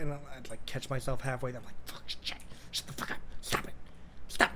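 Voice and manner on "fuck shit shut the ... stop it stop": urgent whispered mock panic; whispered self-scolding. Streamer catches himself halfway, scolding himself in a whisper. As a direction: whisper sharply at yourself to stop.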